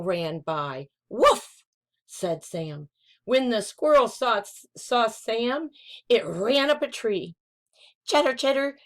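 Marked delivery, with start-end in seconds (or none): 1.1-1.6 high, loud yip; puppy woof. Acted. Puppy barking at a red squirrel, yippy woof. 8.1-8.8 lightly voiced squirrel.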